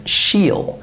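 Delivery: SHE'LL with a full long EE; citation-style SHE'LL, long vowel; isolated full form. Shown as a demonstration. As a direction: say SHE'LL like "sheel". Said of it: "When I say this word by itself".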